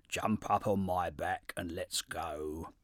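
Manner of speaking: low, slow, drawn-out camel; lumbering camel, unhurried and low. A narrator's reading. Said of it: "he cried".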